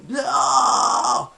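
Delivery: light, high demo scream; weak outward scream. Shown as a demonstration. Vocal coach showing the bare technique, lightly screamed. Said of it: "it was very weak".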